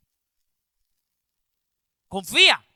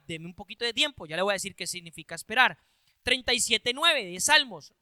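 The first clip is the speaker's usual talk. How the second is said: normal talking voice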